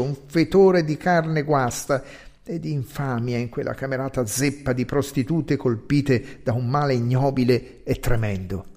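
usual speaking voice

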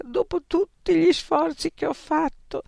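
high, tearful plea; sobbing. A narrator's reading. Frank crying to her friends, sobbing. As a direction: ask it through tears. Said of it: "singhiozzò Frank".

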